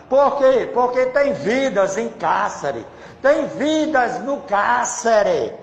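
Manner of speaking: radio preacher in full flow; fervent preaching. Acted.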